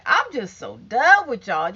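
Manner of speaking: ordinary talk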